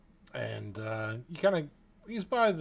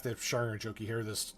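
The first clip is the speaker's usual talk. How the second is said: ordinary delivery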